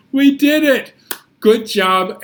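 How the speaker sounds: high, loud celebration; cheerful praise. Real, not acted. Teacher praising children after the rhyme, excited. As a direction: cheer them on.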